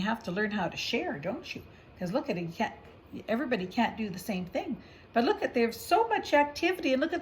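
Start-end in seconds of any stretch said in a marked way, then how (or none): none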